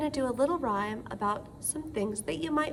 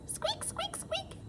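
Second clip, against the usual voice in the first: high squeaky mouse noise; mouse squeaks; 10 dB quieter, slower than usual. Shown as a demonstration. Teacher voicing a mouse for the children, high squeaky. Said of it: "a creature that squeaks".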